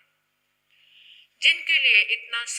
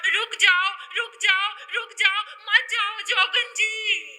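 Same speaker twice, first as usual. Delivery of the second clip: building from raised to high, loud begging; desperate pleading; 9 semitones higher, 9 dB louder than usual. Acted. Whole town begging the jogan to stay, mounting desperation. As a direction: beg over and over, climbing ever higher.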